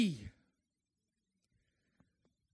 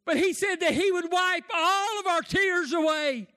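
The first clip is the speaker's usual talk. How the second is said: fervent preaching; 4 semitones higher than usual. Acted. Preaching at full force.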